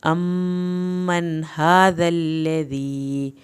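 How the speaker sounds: أمن with the doubled m held one alif long; أمن said slowly, meem lingered on. Shown as a demonstration. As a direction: hold the m in أمن for a full beat. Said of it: "میم کی شد پہ ایک الف کے برابر دیر لگانی ہے".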